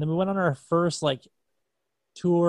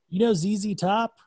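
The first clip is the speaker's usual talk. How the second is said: higher, louder sly question; teasing question; 7 semitones higher, 6 dB louder than usual. Retold or imitated. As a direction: toss it off as a cheeky hint. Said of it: "he was like".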